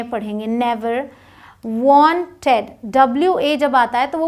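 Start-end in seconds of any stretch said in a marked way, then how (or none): 1.6-2.6 wanted slowly in two pieces, won-ted; broken into syllables. Shown as a demonstration. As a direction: pause inside wanted between syllables. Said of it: "छोटे-छोटे हिस्सों में तोड़ के". (Wanted को दो हिस्सों में तोड़कर)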